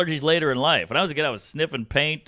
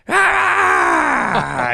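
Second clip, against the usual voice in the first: loud high mock sneeze ah; imitated huge sneeze; 14 semitones higher, 5 dB louder than usual. Imitating his dad's earth-shaking sneeze, a loud high ah. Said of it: "My dad, if he sneezed".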